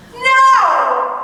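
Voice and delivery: sudden loud cry of No; emphatic no. Acted. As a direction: burst out with it.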